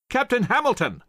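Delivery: high-pitched call over the din. A narrator's reading. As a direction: hail a captain who hasn't heard. Washington calls again over din.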